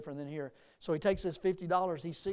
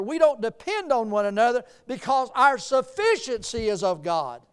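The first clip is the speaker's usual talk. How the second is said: loud, higher-pitched, driving sermon delivery; full-force preaching; 5 semitones higher, 9 dB louder than usual. Real, not acted. Preacher proclaiming God's sufficiency to the church, full-force preaching. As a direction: build with rhythmic emphasis and proclaim it.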